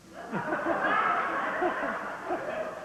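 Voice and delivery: airy burst of laughter; breathless laugh. Real, not acted. Remembering the absurd line, airy laughter. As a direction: silent shaking laugh.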